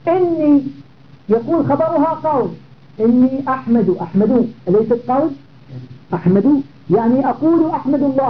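usual speaking voice

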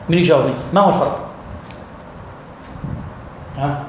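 usual speaking voice

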